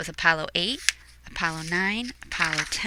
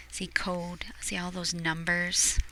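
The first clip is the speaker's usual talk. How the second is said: whispered aside; 3 semitones lower, 9 dB quieter than usual. Real, not acted. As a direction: lean in and whisper it. Aside to children.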